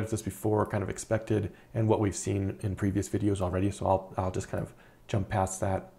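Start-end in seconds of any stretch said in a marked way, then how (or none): none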